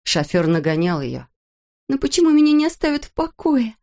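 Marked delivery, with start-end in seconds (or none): 1.9-3.8 high, panicked plea; desperate high cry. Acted. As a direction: cry it out in despair. Desperate Molly fleeing.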